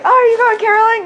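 high bright mock-cheerful question; chirpy quote. Retelling asking Trey about caroling, mock-cheerful. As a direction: ask it sweetly, playing innocent. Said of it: "I was like".

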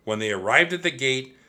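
plain reading voice

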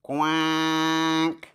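one low, long-drawn quack; duck quack. Shown as a demonstration. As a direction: draw out a single duck quack. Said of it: "Now bring out Duck".

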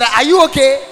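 concerned loud check. Retold or imitated. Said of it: "Then he said".

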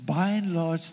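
ordinary delivery

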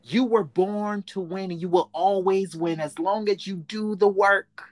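rising, fervent pep talk; booming motivational speech. Acted. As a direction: lift them up like a coach. Host motivational push to listeners.

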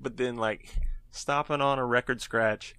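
usual speaking voice

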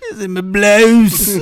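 high, slow, laughing fake British; hammed-up posh Brit, laughing; mock English accent.

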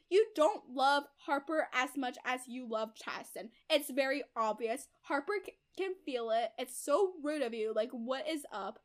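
loud, emphatic retold scolding; accusing. Retold husband confronting wife over favouring one daughter, accusing. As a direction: confront her, stretching the key words. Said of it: "And then he was like".

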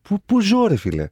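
high-pitched incredulous outburst; real disbelief. Real, not acted. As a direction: exclaim it high, as if you missed huge news. Just learned [name] is coming.

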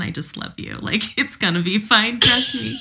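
put-on light Southern accent, tender and teasing; Southern God voice. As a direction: reassure gently in a Southern accent, ending in a laugh. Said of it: "he's like".

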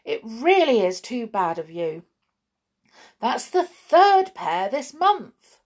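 loud, emphatic telling-off; vexed mother laying down the facts. A narrator's reading. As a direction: scold with mounting exasperation.

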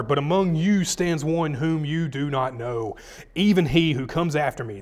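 loud, declaiming Bible reading; scripture reading. Acted. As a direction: proclaim the verses to the room. Reads Pharisees' question and answer.

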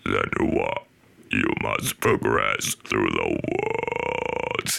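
very low, drawn-out villain croak on an in-breath; mimicked game villain, words sucked inward; inhaled bad-guy voice. Shown as a demonstration. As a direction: talk while breathing in, slow and creaky. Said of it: "how I can mimic this guy's voice".

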